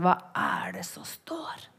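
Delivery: whispered question. Acted. Asking what the scripture says, whispered. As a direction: lean in and whisper it.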